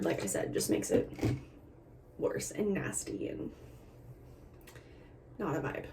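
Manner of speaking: hushed, fading disgust; whispered complaint. Real, not acted. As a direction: mutter it away under the breath.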